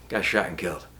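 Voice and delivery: put-on low, soft, matter-of-fact kid; understated, downbeat deadpan; deadpan reply. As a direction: toss it off quietly, no big deal. Kid's casual reply about dead father.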